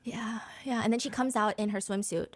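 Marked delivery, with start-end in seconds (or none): 0.0-0.8 whisper. Real, not acted. Whispered aside after gossip.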